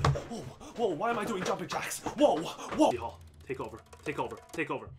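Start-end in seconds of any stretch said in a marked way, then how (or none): 3.0-4.9 very low, soft muttered chant; hushed urging. Real, not acted. Gamer willing Hall to take over, hushed urging. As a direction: murmur it like a private plea.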